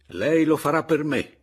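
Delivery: loud, high, forceful demand; energetic shout. A narrator's reading. Narrator presses Anselmo to help, shouting energetically. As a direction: cry it out as an order. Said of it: "gli gridai energicamente".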